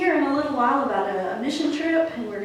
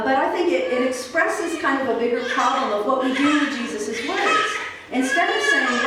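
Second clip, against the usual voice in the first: normal talking voice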